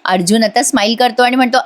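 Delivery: ordinary talk, nothing marked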